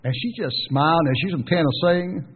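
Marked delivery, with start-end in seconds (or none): 1.4-2.2 imitated Tennessee drawl, last syllable as "say"; Tennessee accent. Preacher teasing about a friend's wife's home state, imitated Tennessee accent. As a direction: say "Tennessee" like a local, ending in "say". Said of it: "you could tell she was from Tennessee".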